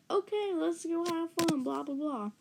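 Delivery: lightly mocking, high singsong start that drops away; a touch of sarcastic pep; mock agreement. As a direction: feign going along, trailing into dismissal. Kid's grudging mock agreement, retold.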